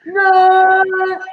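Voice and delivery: high shrieking dinosaur roar. Shown as a demonstration. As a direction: screech it out like a dinosaur. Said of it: "I'm gonna show you how to do a dinosaur impression".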